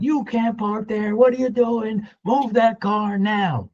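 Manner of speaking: high, slow, barked orders in a put-on voice; imitated angry yell. Attendant ordering the Maserati driver to move, high slow bark. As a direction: bark it out like a rude attendant ordering a car moved. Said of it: "screaming at me".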